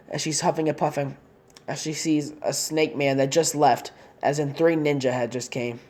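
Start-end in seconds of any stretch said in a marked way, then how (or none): none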